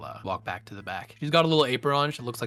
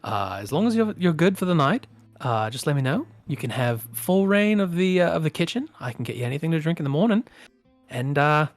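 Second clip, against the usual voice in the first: hospitable hostess; 5 semitones higher, 5 dB louder, faster than usual. Acted. Val settles the guest in.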